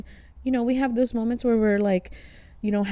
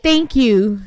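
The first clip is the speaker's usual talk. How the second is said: louder, effusive "Thank you" to the barista; gushing thanks; 8 dB louder than usual. Retold or imitated. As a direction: overflow with gratitude. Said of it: "do you just wanna be like".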